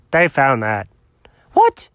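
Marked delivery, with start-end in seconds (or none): 1.5-1.9 sudden high shocked squeak; startled yelp of a question; shocked what. Acted. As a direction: jolt up high in disbelief.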